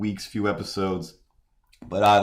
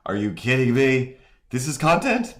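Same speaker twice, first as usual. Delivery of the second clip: incredulous; 5 semitones higher, 4 dB louder than usual. Retold or imitated. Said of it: "have just been like".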